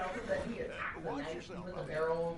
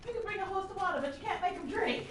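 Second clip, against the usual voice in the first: high-pitched mock proverb; playful character voice; 14 semitones higher than usual. Character remarking on the delirious jaunty guy, high playful voice. Acted quote of story character.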